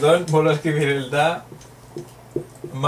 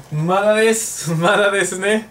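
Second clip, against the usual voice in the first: teasing back and forth in a higher voice; lively mock reply; 5 semitones higher than usual. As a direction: bounce the answers back with mock energy. Playful student-teacher exchange.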